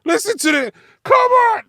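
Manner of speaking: very high, loud come on; exasperated yell. Real, not acted. Fed up with [name]'s line, exasperated yell. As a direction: cry out in disbelief.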